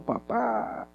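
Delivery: soft, hushed call to a father; a touch of weeping, understated; mild tearful cry. Acted. Joseph weeping softly to his father, mild tearful call. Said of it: "Imagínese a José llorando".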